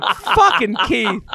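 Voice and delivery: laughing groan. Retold or imitated. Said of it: "I'm like".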